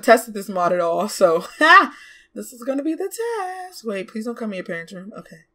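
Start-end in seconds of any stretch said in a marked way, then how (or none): none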